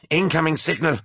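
high, alert crewman's voice; officer's report. A narrator's reading. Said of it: "reported Kassar".

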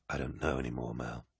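low, soft admission of doubt; low, quiet confession. A narrator's reading. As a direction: admit it quietly, voice sunk low. Weary warlord confides in aide.

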